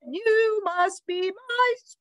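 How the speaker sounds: high sing-song bird voice; insistent bird teacher. A narrator's reading. Bird tells the dog he is her student, high sing-song. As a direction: trill it high, stretching the last word.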